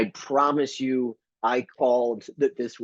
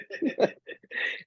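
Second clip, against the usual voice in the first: high breathy laugh; 6 dB quieter than usual. Real, not acted.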